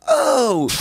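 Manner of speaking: high-pitched loud "Oh!"; performed stage whoop of "Oh!"; punchline "Oh!". Retold or imitated. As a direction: cap the dirty rhyme with a high, loud cry. Acts out the punchline cry after rhyme.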